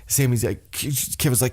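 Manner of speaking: ordinary delivery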